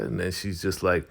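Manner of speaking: normal talking voice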